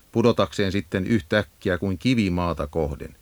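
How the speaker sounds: usual speaking voice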